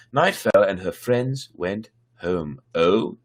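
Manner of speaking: measured storybook reading voice; story narration. A narrator's reading. Storyteller reading a Russian fairy tale to viewers, lightly voiced. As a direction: read the tale aloud to listeners.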